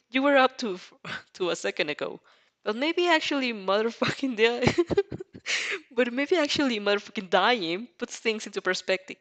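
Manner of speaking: ordinary delivery, nothing marked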